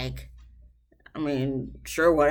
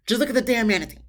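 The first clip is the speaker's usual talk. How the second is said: mock frustration, raised pitch; imitated frustrated partner; 5 semitones higher, 4 dB louder, much faster than usual. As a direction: imitate a fed-up partner, higher and pointed. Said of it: "about to get to that point".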